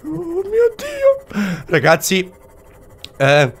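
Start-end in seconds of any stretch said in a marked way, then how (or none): 0.0-1.6 very high falsetto whimpering squeal; scared witless. Real, not acted. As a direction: wail in panic, far above your own voice. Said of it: "Mi sono cagato sotto".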